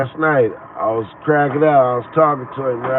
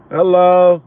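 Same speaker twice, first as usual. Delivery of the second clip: loud, high "Hello?" like it's obvious; emphatic hello; 6 semitones higher, 9 dB louder than usual. Real, not acted. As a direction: call out "Hello?" as if stating the obvious. Emphatic aside to listener.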